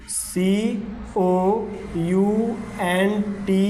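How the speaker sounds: very slow, letter by letter; drawn-out letters, paced for writing along; slow spelling. Shown as a demonstration. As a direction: spell it out with long gaps between letters. Teacher spells word while writing.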